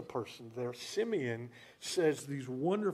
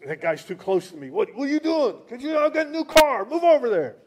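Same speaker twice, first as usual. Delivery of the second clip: loud, much higher yell at the tailgater; anxious driver yelling; 6 semitones higher, 10 dB louder than usual. Retold or imitated. Driver yelling at a car too close behind, loud and high. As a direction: protective new-car owner shouting, louder and louder. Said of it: "and you just".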